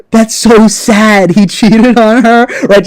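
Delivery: half-laughing mock pity; laughing mock sympathy. Real, not acted. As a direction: laugh through a fake-sorry line.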